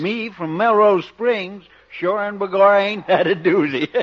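put-on Irish accent. Irish cop.

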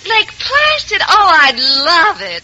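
high surprised start, then drawn-out delight; thrilled, lingering on the words. Acted. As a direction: accept with gushing pleasure. Delighted at the invitation.

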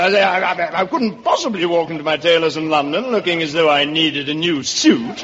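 put-on incredulous upper-crust English accent. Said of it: "that's a wonderful English accent".

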